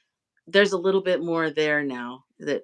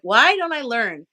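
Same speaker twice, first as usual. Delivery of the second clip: self-scolding; 5 semitones higher, 6 dB louder than usual. Retold or imitated. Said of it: "I'm like".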